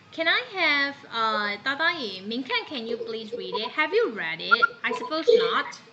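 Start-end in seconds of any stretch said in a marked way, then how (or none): none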